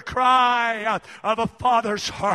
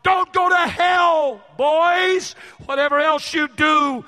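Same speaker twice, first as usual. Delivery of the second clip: loud, high, urgent plea, slowing on key words; a broken father crying out to his boys to live for God; pleading father; 3 semitones higher, 3 dB louder than usual. Retold or imitated. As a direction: beg your sons with everything you have. Weeping father pleading with sons.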